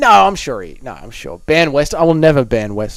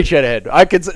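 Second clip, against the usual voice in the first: ordinary delivery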